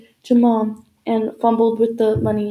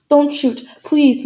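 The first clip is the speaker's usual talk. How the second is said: louder, higher plea for mercy; raised, urgent begging; 4 semitones higher, 6 dB louder, slower than usual. A narrator's reading. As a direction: plead urgently at gunpoint. Father pleads at gunpoint.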